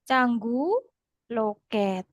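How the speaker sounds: stretched syllables, left hanging; slow dictation. Shown as a demonstration. Teacher translating phrase by phrase for students, slow dictation. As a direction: draw it out while they follow along.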